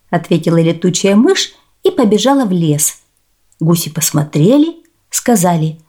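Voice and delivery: usual speaking voice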